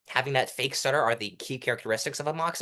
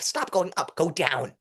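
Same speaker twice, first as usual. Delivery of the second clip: usual speaking voice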